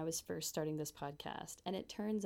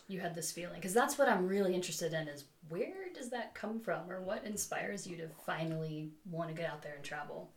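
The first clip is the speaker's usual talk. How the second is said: ordinary delivery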